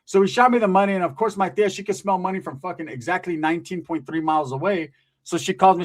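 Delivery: usual speaking voice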